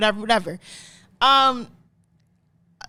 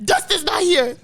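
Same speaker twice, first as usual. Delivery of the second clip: higher-pitched mock tough talk; flexing back; 5 semitones higher than usual. Joking as the friend who won't be Dustin, mock defiant. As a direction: warn them off, hammed-up and defiant. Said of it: "he started flexing back".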